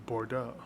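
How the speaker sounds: "Bordeaux" low and soft, ending in an oh; French-style "Bordeaux"; French pronunciation. Shown as a demonstration. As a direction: say "Bordeaux" quietly with a silent x. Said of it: "if you're gonna say any French word".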